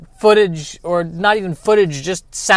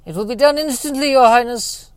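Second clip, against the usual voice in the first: higher, quick, eager servant voice; eager henchman; 7 semitones higher than usual. Acted. Balthazar promises his king it will be done, eager and high. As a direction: chirp it like a keen lackey.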